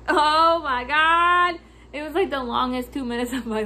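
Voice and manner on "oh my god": long, loud, high "oh my God"; dramatic exasperation. Retold or imitated. Clerk holding tape during photos, drawn-out loud dismay. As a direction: draw every word out in alarm. Reliving fear of tape snapping.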